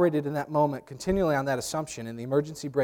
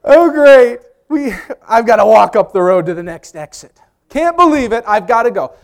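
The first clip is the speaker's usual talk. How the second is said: loud, higher fed-up rant; exasperated dad; 6 semitones higher, 11 dB louder than usual. Retold or imitated. Father to mother by the highway, exasperated rant. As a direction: grumble he must walk to the exit. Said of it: "as only my dad could do".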